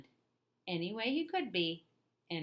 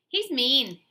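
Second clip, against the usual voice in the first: put-on kids complaining; 7 semitones higher, 12 dB louder than usual. A narrator's reading. Kids complain about Eddie.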